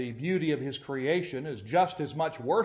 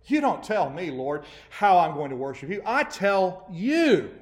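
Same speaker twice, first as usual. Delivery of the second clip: defiant demand; 4 semitones higher, 6 dB louder than usual. Retold or imitated. Preacher voicing worshippers defying God, loud and defiant. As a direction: throw it back at God, daring. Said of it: "the conveying of that attitude".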